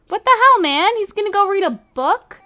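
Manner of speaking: high, loud disbelief; exasperated surprise. Real, not acted. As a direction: react like he just ditched you. Noah walks off to read.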